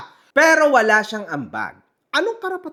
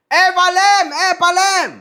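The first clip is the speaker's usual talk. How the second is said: loud, higher mock-angry call of Epalem; angry post; 9 semitones higher, 7 dB louder than usual. Sibling scolding the vlogger in a post, loud mock anger. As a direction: shout the name like an accusation. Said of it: "sinabi niya na".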